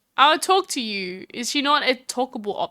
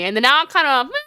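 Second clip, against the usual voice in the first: normal talking voice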